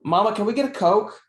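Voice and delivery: put-on high little-girl voice asking mom. Daughter asking mom for a Coke, high little-kid voice. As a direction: play the youngest daughter pleading at the soda case. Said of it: "she says".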